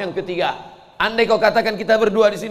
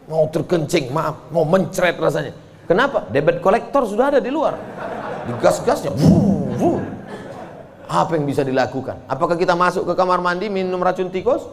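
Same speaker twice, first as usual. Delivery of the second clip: ordinary talk, nothing marked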